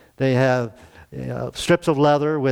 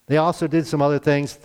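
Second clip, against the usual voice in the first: ordinary talk, nothing marked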